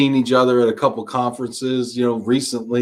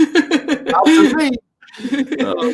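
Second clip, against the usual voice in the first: laughing; 14 semitones higher, much slower than usual. Real, not acted. Laughing at guest's confusing answer.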